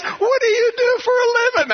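squealing high, helplessly amused question; laughing cop. Retold or imitated. Officer asking the speeder his job, in high-pitched hilarity. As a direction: imitate a cop barely holding it together. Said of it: "he's laughing".

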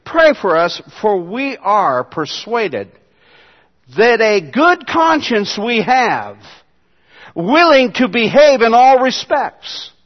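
slow emphatic scripture read. Shown as a demonstration. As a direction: read it slowly, building weight. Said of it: "let me read it to you in the Greek again".

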